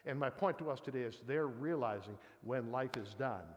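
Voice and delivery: ordinary talk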